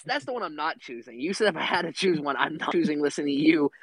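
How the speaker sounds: ordinary talk, nothing marked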